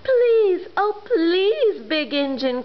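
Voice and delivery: high, pleading little toy voices. Acted. Dolls and toys begging the big engine, pleading. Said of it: "cried all the dolls and toys together".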